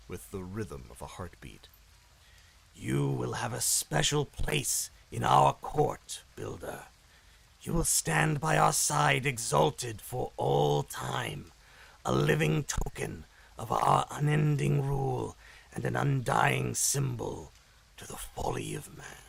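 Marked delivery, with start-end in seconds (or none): 2.7-17.5 slow rasping demon queen, grandly promising; raspy demon voice. Acted. Queen luring the Builder into her court, slow raspy demon voice. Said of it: "the raspy demon voice". 17.9-19.2 a breathy demon whisper to close the threat. Acted. Queen mocking mankind's folly, eerie whispered close.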